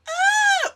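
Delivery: high, loud mock scream from next door; mock sex scream. Neighbours heard through the wall, mocked with a loud high scream. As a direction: belt out a hammed-up cry of passion. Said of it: "you would just hear".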